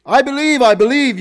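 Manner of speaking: high, loud, eager cries; frantic yelled promise; yelled back. Retold or imitated. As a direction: shout it up the cliff in a hurry. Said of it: "yelled back the man".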